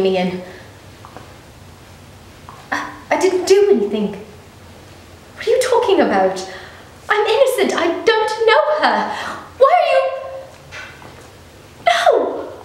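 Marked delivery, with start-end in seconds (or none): 2.7-10.0 much higher and louder, frantic pleading; panicked protest. Acted. Man being taken in by agents pleads innocence, panicked. 11.9-12.5 much higher, loud anguished cry of no; desperate cry. Acted. Man dragged away by agents cries out, desperate. Resisting arrest.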